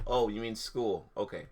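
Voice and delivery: ordinary talk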